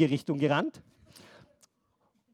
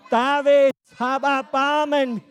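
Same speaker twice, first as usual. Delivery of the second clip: a louder, slow desperate shout; louder cry; 4 semitones higher, 5 dB louder, slower than usual. Shown as a demonstration. Blind man shouting louder so Jesus hears. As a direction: shout it out slowly, straining to be heard. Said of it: "Das muss schon lauter sein".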